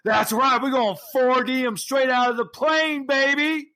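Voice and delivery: very high, strained promo holler; hammed-up high-pitched bravado; hyped-up wrestler. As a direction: boast it like a tag champion to the crowd. Champion partner acts promo boast.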